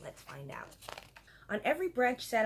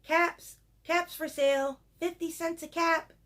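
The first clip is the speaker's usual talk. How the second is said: high, loud street-vendor cry; calling out wares; 8 semitones higher, 7 dB louder than usual. Acted. Peddler hawking caps around town, calling out. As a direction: sing out your wares to the street.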